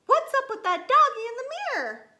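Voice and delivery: fast, high, loud kitten voice; startled exclamation. A narrator's reading. Kitten spots a doggy in the mirror, high fast exclamation. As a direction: fire it off high and loud, amazed.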